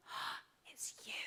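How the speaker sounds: imitating a gasp of recognition; whispered, breath-caught astonishment; stunned whisper. Retold stunned recognition in cafe.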